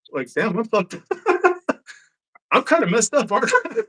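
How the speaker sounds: high-pitched, words breaking into laughter; amused, laughing through the quote; laughing self-realization. Retold or imitated. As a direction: crack up while admitting it.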